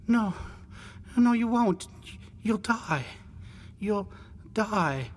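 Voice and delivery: high, halting adolescent warning; distressed boy. Acted. Boy warns brother of death.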